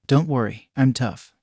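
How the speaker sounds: ordinary delivery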